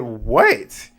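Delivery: high, loud, incredulous What; stunned what. Real, not acted. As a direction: squeak out your shock. Reacting to shocking reveal.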